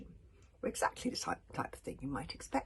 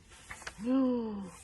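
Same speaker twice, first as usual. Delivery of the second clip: 1 gasp of horror; 4 semitones higher, 6 dB louder than usual. A narrator's reading. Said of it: "a collective gasp of horror".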